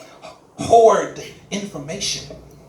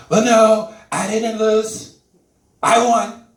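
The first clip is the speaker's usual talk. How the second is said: higher, louder voice of stubborn denial; Trump; 5 semitones higher, 6 dB louder, slower than usual. Acted. President refusing to accept he lost, put-on insistent denial. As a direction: deny it in drawn-out, raised tones. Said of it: "if somebody could just tell Trump".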